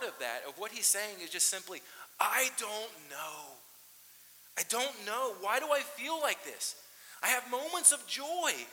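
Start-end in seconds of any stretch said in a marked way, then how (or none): 2.2-8.7 high, slow, baffled I don't know; bewildered lament. Retold or imitated. Pastor speaking as the psalmist, loud bewildered. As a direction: search helplessly for an answer.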